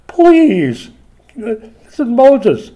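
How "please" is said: drawn-out, high, loud whining plea; begging. Acted. Moses begging God to relent, whiny plea. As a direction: plead with God, voice pitched way up. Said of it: "He's begging".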